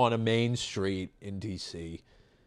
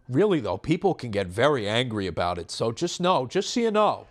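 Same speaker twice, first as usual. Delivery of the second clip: impression of the woman; 3 semitones higher than usual. Quoting the woman's parting warning, pitched up. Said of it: "a very good impression of this woman".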